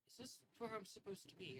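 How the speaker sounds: a meek cleric asking if he's in the right place; lost, hesitant elf cleric; timid gaunt elf. Acted. Gaunt elf cleric arrives unsure.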